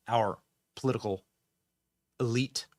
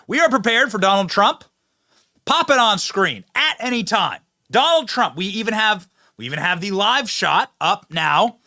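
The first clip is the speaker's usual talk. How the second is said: high, loud show-host announcement; announcer; 8 semitones higher, 8 dB louder than usual. Real, not acted. Host promising viewers a live shot, announcing loudly.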